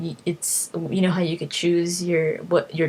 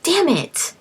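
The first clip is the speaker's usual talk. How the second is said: higher, exasperated damn it; annoyed at being lost; mock frustration; 10 semitones higher than usual. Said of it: "I'm just like".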